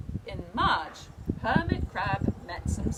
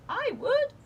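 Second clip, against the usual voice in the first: much higher, tiny and eager; small sea star voice; 8 dB louder than usual. A narrator's reading. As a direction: squeak it out like a little star. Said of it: "signaled the little sea star".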